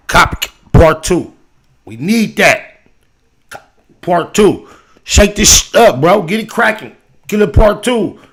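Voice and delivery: loud, high, emphatic urging; fired-up hype. Real, not acted. Host urging [name] to make Cop Part Two, fired up. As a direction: hype him up at full volume, punching each phrase. Host hypes a rapper to record again.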